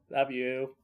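ordinary talk, nothing marked

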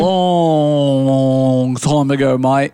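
slow, drawn-out Aussie voice; Australian accent. Acted. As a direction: lay the Aussie accent on thick, slowly. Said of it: "by your accent that you're from Australia".

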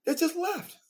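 amused outrage, laughing; laughing disbelief. Real, not acted. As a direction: laugh at how absurd it was. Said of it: "Yeah, what the fuck?".

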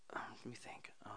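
whispered aside to yourself; very soft, voiceless musing; thinking aloud. Real, not acted. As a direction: drop to a near-silent murmur while searching your memory. Thinking aloud, searching memory.

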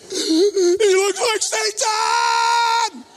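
high, loud, dragged-out wail; mock horrified shout. Preacher looks at his seatmate, mock terrified shout. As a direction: cry it out in horror.

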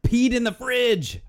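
high exclamation of disbelief; incredulous echo. Real, not acted. Friend hearing of childhood sleepwalking, incredulous high echo.

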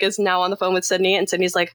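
ordinary talk, nothing marked

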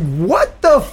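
high, drawn-out disbelief; retold exasperation. Customer refused breakfast, exasperated disbelief. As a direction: pitch it way up, pausing before the swear.

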